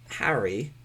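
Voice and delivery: Harry in an imitated British accent. Shown as a demonstration. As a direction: say Harry as the Brits do. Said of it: "The Brits say".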